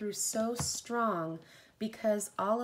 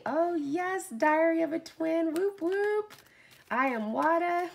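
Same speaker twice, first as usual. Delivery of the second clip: excited shout-out; 8 semitones higher, 6 dB louder than usual. Real, not acted. Cheering chat shout-outs.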